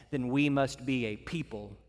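normal talking voice

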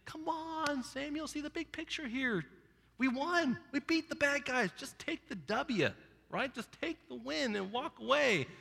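high, coaxing excuse-maker; wheedling Saul. Retold or imitated. Saul pleading with Samuel, high and coaxing. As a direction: play Saul talking Samuel into the win. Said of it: "It's kinda like he's saying".

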